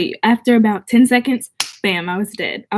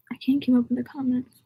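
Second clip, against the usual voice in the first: whispered aside to herself; 8 dB quieter than usual. Real, not acted. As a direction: breathe it out, no voice, overwhelmed by the comments. Overwhelmed by comments, whispering aside.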